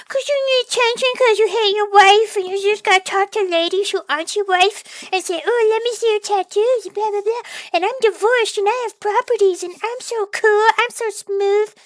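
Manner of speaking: high, mocking whiny voice; mocking sleazy flirt. Vlogger mocks a married man chatting up women, high and sneering. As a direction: ham up the creep trying to impress ladies.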